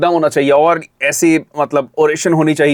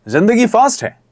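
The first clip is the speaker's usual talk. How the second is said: higher, punchy energetic line read; fast touch; 6 semitones higher than usual. Shown as a demonstration. Coach demonstrating the ad's opening line, fast touch. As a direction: give the words a lively, quick lift. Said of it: "fast वाला touch लेके आओ".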